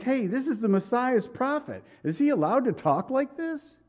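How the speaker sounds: high, scandalised mock questioner; shocked listener. Churchgoer doubting the prophet, high scandalised voice. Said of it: "And you think".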